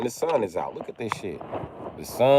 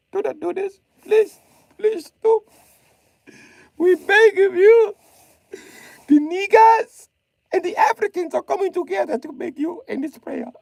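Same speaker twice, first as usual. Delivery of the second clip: mock pleading; 5 dB louder than usual.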